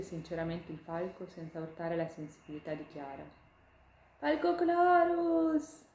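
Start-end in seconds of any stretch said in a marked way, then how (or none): 4.2-5.8 high, loud, slow squeal of a tiny ladybug; a ladybug bursting out of the basket, calling; excited ladybug. Acted. Ladybug leaping from the basket to the hawk, high excited call.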